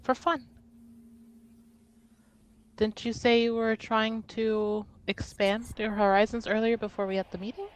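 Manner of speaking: normal talking voice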